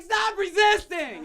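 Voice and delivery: high, loud police command; cop shouting. Acted. Cop yelling at the parents to stop resisting. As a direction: bark it like an arresting cop.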